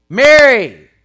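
preacher voicing Jesus calling Mary; calling her name. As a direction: call out "Mary" so it carries. Jesus calls her name again.

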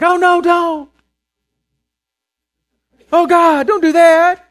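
put-on high, loud, frightened child's wail; child pleading in fear. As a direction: beg like a scared kid about the thorn. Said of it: "they're like".

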